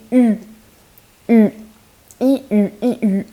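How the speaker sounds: U as a rounded E, lips in a circle; rounded front vowel. Shown as a demonstration. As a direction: say E, then U with the mouth rounded into a circle. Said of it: "make your mouth a circle".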